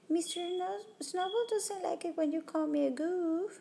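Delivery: put-on high, childlike voice for the bear's feelings; little boy pretending his toy bear is upset; lightly voiced small child; child's pretend voice. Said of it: "he's pretending that the bear is alive".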